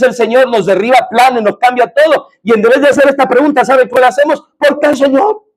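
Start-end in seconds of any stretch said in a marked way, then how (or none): 4.6-5.4 high-pitched questioning complaint; complaining question. Acted. Preacher mimics the wrong question we ask the Lord, high-pitched complaint. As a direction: ask why on a rising, protesting note. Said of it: "esa no es la pregunta".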